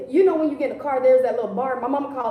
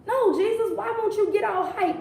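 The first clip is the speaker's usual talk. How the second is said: higher, exasperated mock pleading; higher hammed-up pleading; pleading disciples; 8 semitones higher than usual. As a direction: beg him to react.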